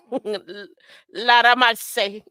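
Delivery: loud, stretched Jamaican exclamation; Jamaican dancehall. Acted. Host clowning to viewers, slow Jamaican dancehall cry. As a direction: break from a laugh into a dancehall cry. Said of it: "go into a Jamaican dance".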